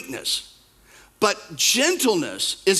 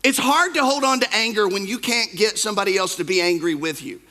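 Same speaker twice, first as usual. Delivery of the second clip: normal talking voice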